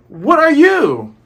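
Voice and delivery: high, loud, drawn-out mock yell; Luffy shouting back. Reader plays Luffy echoing the voice, exaggerated high yell. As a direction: holler it back, slow and high-pitched. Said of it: "And Luffy's like".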